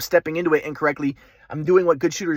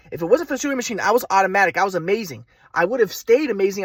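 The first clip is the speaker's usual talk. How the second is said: ordinary talk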